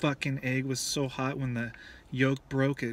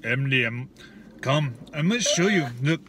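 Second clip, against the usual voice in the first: put-on loud, broken-English Russian accent; 3 dB louder than usual. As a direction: play a pushy Russian tour guide, Russian accent. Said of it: "I love your Russian accent".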